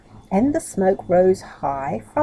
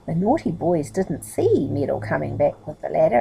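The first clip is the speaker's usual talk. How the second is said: usual speaking voice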